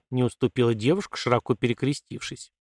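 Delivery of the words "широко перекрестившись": ordinary delivery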